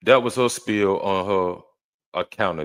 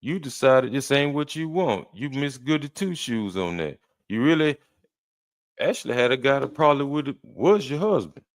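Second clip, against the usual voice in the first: higher-pitched, argumentative comeback; indignant retort; 6 semitones higher than usual. Real, not acted. Host arguing back at the woman's video, indignant. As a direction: fire back in a raised, challenging pitch.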